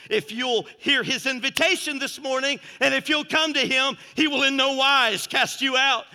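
insistent, driving sermon appeal; fervent preaching. Real, not acted. Preacher inviting the hurting to come to Him, fervent preaching. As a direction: press the invitation home to the listeners.

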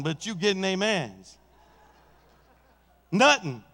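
normal talking voice

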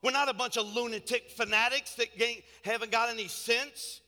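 rally the church with emphatic, rolling phrases; animated preaching. Real, not acted. Preacher defending the congregation as smart people, animated and emphatic.

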